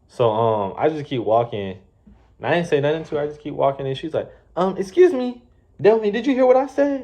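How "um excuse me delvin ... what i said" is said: high, pointed mock of the boss; snippy manager. Manager demanding Delvin answer her, mocking high snip. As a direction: imitate her huffy demand.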